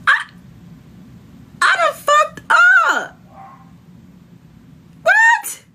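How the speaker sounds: high, far-off panicked squeal. Retold or imitated. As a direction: squeak out the horror of realizing the mistake. Panicking over her sneakers.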